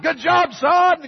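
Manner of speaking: high, exuberant praise; proud cheering. Retold or imitated. Father to his son after the thank-you, proud cheering. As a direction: cheer him on, voice leaping up.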